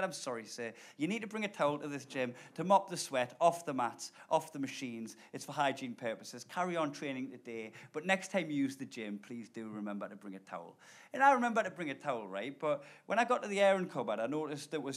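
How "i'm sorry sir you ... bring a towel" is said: softly polite gym worker, much lower; calm, quiet and apologetic. Retold or imitated. Gym worker apologising politely to the startled narrator, quiet.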